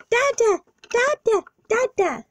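put-on very high little baby voice; short, high baby calls; toddler calling Daddy. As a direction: squeak it out like a toddler.

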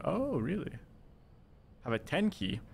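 surprised. Real, not acted. Surprise at keyboard keys.

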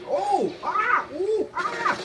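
series of very high shrieks; mock screams. Gamer in a firefight shrieking high and loud. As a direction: squeal in panic.

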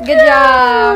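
high, loud excited praise. Real, not acted. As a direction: cheer the dog on. Praising the puppy.

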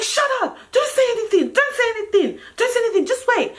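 high-pitched, insistent, repeated warning; urgent hushing order. Retold or imitated. Friend ordering her to say nothing, urgent and high.

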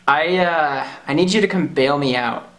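usual speaking voice

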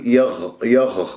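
"يغ" with airy, raspy kh at the end; ghayn turned kha. Shown as a demonstration. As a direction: let breath scrape through the ghayn of "يغ". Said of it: "لا تجعلها خاء تشممها هواء".

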